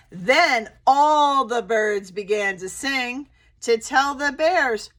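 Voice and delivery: storybook read-aloud with animal voices; gentle picture-book reading; reading to children. Acted. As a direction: read it like story time for little kids.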